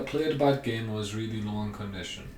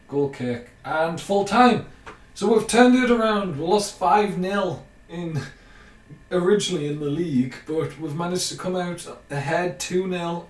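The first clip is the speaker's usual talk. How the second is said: higher and louder, thrilled; excited full-time celebration; 6 semitones higher, 7 dB louder than usual. Real, not acted. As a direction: celebrate the comeback win loudly. Celebrating comeback win at full time.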